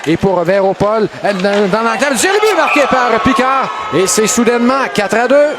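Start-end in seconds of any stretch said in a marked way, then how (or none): 2.2-5.5 higher, shouted goal call; excited sports commentary. Real, not acted. Goal call in hockey play-by-play.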